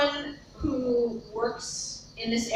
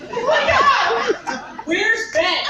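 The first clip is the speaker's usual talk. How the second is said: higher and louder, slow laughing panic; panicked exclaim; 11 semitones higher, 6 dB louder, much slower than usual. Retold or imitated. Retelling the panic on hearing the news, laughing exclaim. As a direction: blurt it out in alarm. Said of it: "but I didn't say cow".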